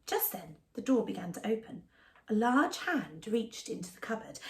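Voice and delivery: ordinary delivery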